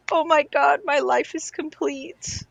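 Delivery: high gushing, slowing at the end; imitated delighted fan. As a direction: gush like an overjoyed fan. Retold fan's delighted reaction.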